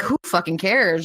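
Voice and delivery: ordinary delivery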